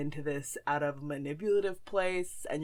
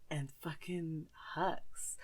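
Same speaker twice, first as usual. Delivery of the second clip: whisper; 10 dB quieter, slower than usual. Real, not acted. Confiding a guilty pleasure.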